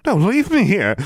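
high, loud desperate wail. A narrator's reading. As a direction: wail it in despair. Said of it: "Goody Pruitt wailed".

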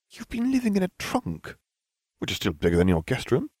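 lightly voiced schoolgirl; Hermione's voice. A narrator's reading. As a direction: point it out dryly. Hermione retorts.

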